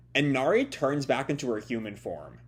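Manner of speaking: usual speaking voice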